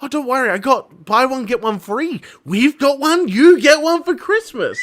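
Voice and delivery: mock-mum bargain excitement; chirpy mum. Acts out mum's cheerful bargain pitch.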